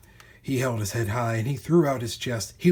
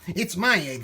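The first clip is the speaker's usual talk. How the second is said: sputtering; 5 semitones higher, 3 dB louder than usual. A narrator's reading. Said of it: "she sputtered".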